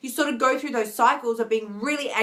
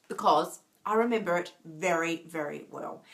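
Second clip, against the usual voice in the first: real emotion, softer and quiet; choked up; 5 semitones lower, 3 dB quieter than usual. Real, not acted. Talking to viewers about past depression, softly choked up. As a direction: voice catching as she admits she is emotional. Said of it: "so it makes me emotional".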